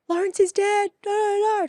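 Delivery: high, loud anguished cry of disbelief; distraught wail. Retold or imitated. Girl to her mum after his death, wailing in anguish. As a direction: wail it like the news just hit. Retold sobbing reaction to death news.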